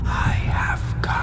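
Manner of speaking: slow, eerie unvoiced whisper; dark spirit. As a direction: loom like death itself. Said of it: "The Dark One".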